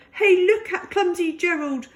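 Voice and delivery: high, loud mocking call; teasing crowd, calling out high; laughing jeer. A narrator's reading. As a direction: jeer at the clumsy one. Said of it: "the animals all laughed".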